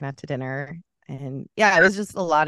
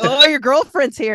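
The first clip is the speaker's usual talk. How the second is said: mock taunt; 9 semitones higher, 10 dB louder than usual. Retold taunt from bar men.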